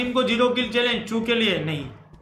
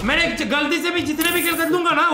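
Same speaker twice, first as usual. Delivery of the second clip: ordinary delivery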